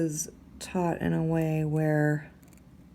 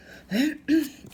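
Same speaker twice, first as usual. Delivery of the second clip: loud rough throat clear; 11 semitones higher, 6 dB louder than usual. Real, not acted. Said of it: "a frog in my throat".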